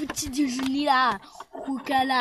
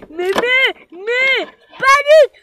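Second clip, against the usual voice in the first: angry high yell; 7 semitones higher, 9 dB louder than usual. Acted. Arguing with older housemate.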